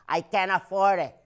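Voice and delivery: put-on lightly done Mexican accent, rising on first; a hint of a Mexican mom telling her story. Mom telling everyone her story, light Mexican accent. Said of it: "I gotta do the accent".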